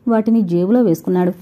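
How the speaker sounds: ordinary delivery, nothing marked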